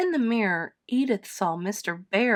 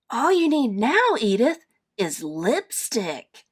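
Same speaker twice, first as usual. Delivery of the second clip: eager little bear, high at the start; lightly voiced little bear; 4 semitones higher, 4 dB louder than usual. A narrator's reading. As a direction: coax like a mischievous youngster. Little Bear eggs Edith on.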